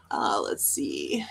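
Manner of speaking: low whisper to herself; whispered, half to herself; whispered aside. Real, not acted. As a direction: breathe it out as a private aside. Reading comments aside.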